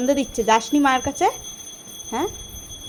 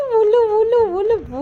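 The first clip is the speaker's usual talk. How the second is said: high cooing; 7 semitones higher than usual. Real, not acted. Cooing at the cat.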